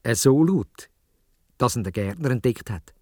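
ordinary delivery

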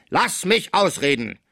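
high, slow, emphatic order; thundering command. A narrator's reading. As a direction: cut off an interruption with drawn-out authority. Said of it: "rief Gaudissart mit Donnerstimme". (herrisch donnernd, gedehnt)

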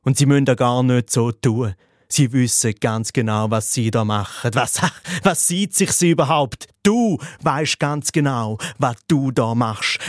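normal talking voice

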